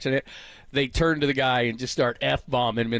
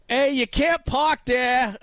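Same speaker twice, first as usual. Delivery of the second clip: high, slow Boston shout out a car window; Boston accent yell; 12 semitones higher, 3 dB louder, much slower than usual. Retold or imitated. Host retelling a viral video, Boston accent yell. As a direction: yell it like a Boston driver passing a crash. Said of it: "he yells out the window in a Boston accent".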